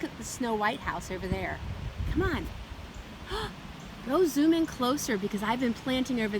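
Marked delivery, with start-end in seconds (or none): 2.5-4.0 breathy surprised gasp; delighted gasp. Acted. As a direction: gasp in wonder.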